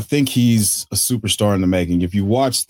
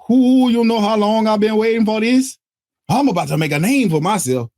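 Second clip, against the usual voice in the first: put-on Cajun accent; 11 semitones higher than usual. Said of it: "Bad Cajun accent aside".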